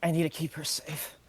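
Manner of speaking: very soft, halting mutter; muttering under breath. Acted. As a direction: mutter it to yourself, barely voiced. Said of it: "I'm just muttering under my breath".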